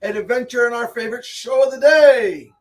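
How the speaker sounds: loud, high, booming show-host welcome; show host intro. Acted. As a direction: open the show like a hyped announcer. Opening the live show.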